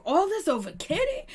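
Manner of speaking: high, puzzled question; confused. Retold or imitated. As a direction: ask it baffled. Voicing confused Ryan.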